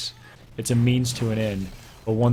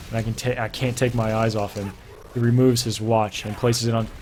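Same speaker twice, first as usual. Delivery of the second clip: usual speaking voice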